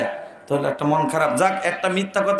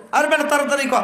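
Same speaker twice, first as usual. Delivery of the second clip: sharp rebuke; 9 semitones higher than usual. Retold or imitated. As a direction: snap at him to hurry. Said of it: "হুজুরে ধমক মারছে".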